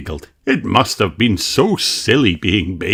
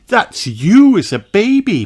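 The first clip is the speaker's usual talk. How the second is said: high, louder motherly line; mother showing a baby photo; mother telling; 12 semitones higher, 5 dB louder than usual. A narrator's reading. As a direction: reveal it to your child. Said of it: "replied Mummy Pig".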